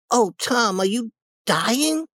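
put-on high, anxious boy's voice; alarmed. Sid believing his brother is dying, high alarm. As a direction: draw out the fearful question up high.

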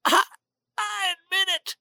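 high, thin, strangled gasp; choked last breath. A narrator's reading. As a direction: squeeze the words out while being throttled. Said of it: "With his last breath".

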